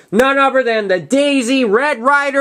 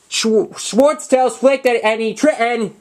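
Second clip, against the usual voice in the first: usual speaking voice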